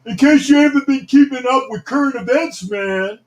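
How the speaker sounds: high, strained movie-character outburst; Bill Paxton impersonation. Acted. Host showing off an impression for viewers, Bill Paxton impersonation. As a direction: do an impression, pitched well above your own voice. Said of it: "gonna do my Bill Paxton impersonation".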